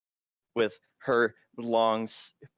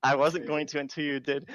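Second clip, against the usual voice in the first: ordinary talk, nothing marked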